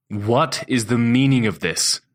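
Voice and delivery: slow, loud courtroom demand; stern boss scolding in his courtroom voice. A narrator's reading. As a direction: boom it out like a judge. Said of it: "My voice is loud and stern".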